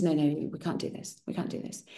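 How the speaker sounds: voiceless, near-silent retold "we can't do this"; hushed refusal. Son refusing his dying father's last wish, in a hush. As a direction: drop to an unvoiced hush, worried.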